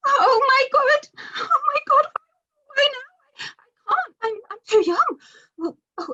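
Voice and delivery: very high, tearful, stammering panic; panicked. Acted. Middle-aged woman begs Death, crying and panicked. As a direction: babble in rising terror, near crying. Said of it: "getting increasingly panicked".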